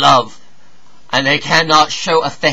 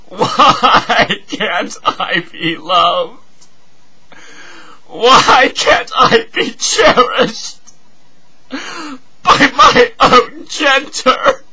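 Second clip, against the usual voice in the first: high, drawn-out crying wail, building higher; anguished tearful cry climbing in pitch; sobbing plea; much slower than usual. Acted.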